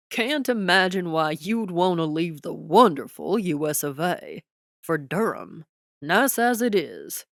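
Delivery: folksy scorn for leaving America; Texas twang. A narrator's reading. President mocks her leaving.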